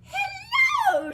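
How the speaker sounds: high, loud fluttery hello; fairy godmother greeting. Acted. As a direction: sing out a grand fairy hello. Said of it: "she said".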